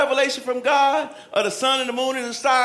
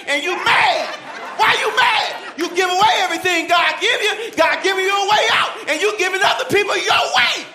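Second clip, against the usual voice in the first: high, loud preaching yell; worked-up shouted rebuke; 8 semitones higher, 5 dB louder than usual. Real, not acted. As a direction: scold the congregation at full volume. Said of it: "Sorry, I'm yelling".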